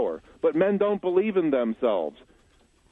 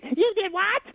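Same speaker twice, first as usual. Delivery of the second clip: very high mock-female falsetto; hammed-up shocked friends firing questions; outraged girlfriends. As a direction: imitate indignant women in shrieky falsetto. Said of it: "And they would say".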